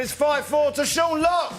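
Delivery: loud, drawn-out show announcement; announcing the winner. Real, not acted. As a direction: call out the final score, building to the name. Host announces the final score.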